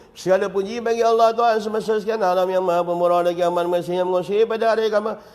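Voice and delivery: fast, gabbled reading of the praise; hurried rote recital; rushed recitation. Shown as a demonstration. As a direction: race through it without meaning. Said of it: "baca pujian macam tadi".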